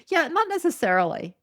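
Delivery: ordinary talk, nothing marked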